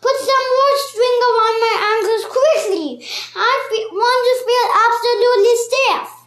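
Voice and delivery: high, loud, fast and breathless; anxious urging. A narrator's reading. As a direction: hurry him along fretfully. Mrs Twit pleading.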